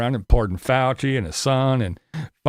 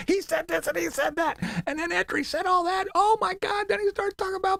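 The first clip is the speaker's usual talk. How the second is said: high, mincing mock gossip voice; 4 dB quieter than usual. Streamer mocking viewers' heads exploding, high mock gossip. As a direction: squeal it like a scandalised gossip.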